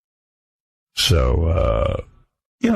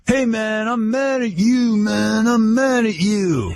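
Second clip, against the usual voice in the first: slow, raised mock Belushi whine, right in his face; imitating Jim Belushi; 9 semitones higher, much slower than usual. Jim Belushi confronting the host in the park, slow mock whine. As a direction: lean in and drag out the grievance. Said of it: "gets right up in my grill and starts like".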